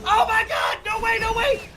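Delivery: screaming at the top of his voice, very high; shrieking jackpot winner. Real, not acted. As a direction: shriek like he just hit the jackpot. Caster screams at a wild play.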